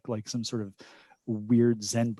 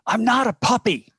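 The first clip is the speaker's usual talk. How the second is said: loud, high yell; yelled retort; 12 semitones higher, 8 dB louder than usual. Acted. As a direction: yell it back across a baseball diamond. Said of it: "And I yell across".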